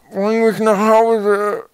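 slow, high, loud full-mouthed moan; unhappy mumbling.

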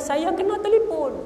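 high, put-upon whine; exasperated husband. Acted. Rich man to bandit chief, whining helplessly. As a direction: plead like a worn-out husband. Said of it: "Dia kata".

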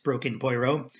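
ordinary delivery, nothing marked

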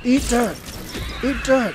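high, loud taunt; fired-up gamer shouting; battle yell. Real, not acted. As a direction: shout it as you hit the enemy. Attacking the enemy.

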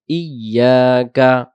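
"ইয়াকা" chanted slowly as iy-yaa-ka; doubled Y. Shown as a demonstration. Teacher modelling the right word, "ইয়াকা" as slow iy-yaa-ka. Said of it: "উচ্চারণ হবে হামজা ইয়াজের ই".

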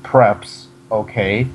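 slow, spaced-out re-reading; deliberate, separated words for the listener; slow repeat. Shown as a demonstration. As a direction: read it out word by word. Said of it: "say that again?".

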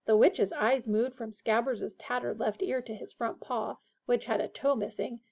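ordinary delivery

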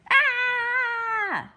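high piercing scream of fright; startled scream. Acted. Girl opening the door to the witch's cat, screaming. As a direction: shriek in terror.

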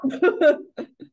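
bright burst of laughter; high laugh. Real, not acted. Laughing after punchline.

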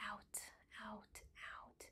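very soft, breathed-out whisper; whispered exhale. Shown as a demonstration. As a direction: let each word go out on the breath. Guiding a long exhale.

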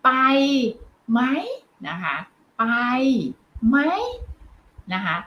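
each word spaced out with long pauses. Shown as a demonstration. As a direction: say ไป ... ไหม ... นะคะ one word at a time. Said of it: "เช่น". (พูดทีละคำเว้นจังหวะ)

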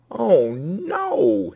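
high, sharp cry of dismay. A narrator's reading. Rooster crying out over juice on his feathers, dismayed. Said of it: "he cried".

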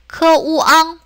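크우앙 split into 크, 우, 앙; level, separated syllables in 크우앙; sounded out separately. Shown as a demonstration. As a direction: break 크우앙 apart for learners. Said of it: "똑같이 분리해서".

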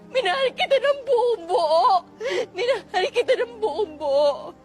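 crying, hurt lover's reproach; tearful heartbreak. Acted. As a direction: sob through the words, breaking down. Betrayed lover breaks down crying. (umiiyak na panunumbat)

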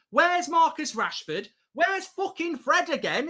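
ordinary delivery

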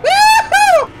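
high, loud joyful cheer; excited whoop. Real, not acted. Gamer cheering while driving through the dam. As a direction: cheer as the car flies on.

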